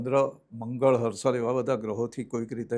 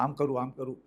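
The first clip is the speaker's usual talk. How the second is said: inner musing; 2 semitones lower, 9 dB quieter than usual. Retold or imitated. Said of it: "વિચારો ચાલ્યા જ કરે".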